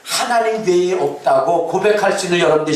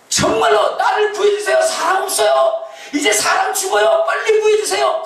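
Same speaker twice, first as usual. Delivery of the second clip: desperate cry for help. Retold or imitated. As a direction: cry out for rescue from the cliff. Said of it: "매달려 있으면서 막 얘기를 한 거예요".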